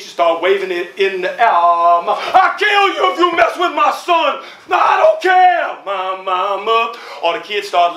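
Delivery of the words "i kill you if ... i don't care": high furious shouting threat; mother screaming a threat on the playground; enraged threat. Acted. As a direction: scream it while waving a gun. Mother waving a gun.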